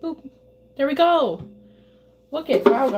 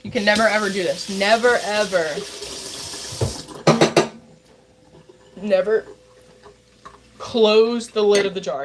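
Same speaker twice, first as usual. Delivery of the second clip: "never ever" punched louder, each "never" hit hard; stress on never; 3 dB louder than usual. Shown as a demonstration. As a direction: hammer every "never" as a firm warning. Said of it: "this is one thing you never do".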